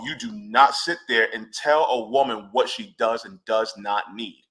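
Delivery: ordinary talk, nothing marked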